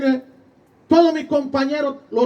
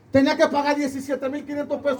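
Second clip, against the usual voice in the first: usual speaking voice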